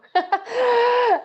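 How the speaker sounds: bursting into a loud high laugh; real delighted laughter, loud and high. Real, not acted. Laughing at joke about advisor.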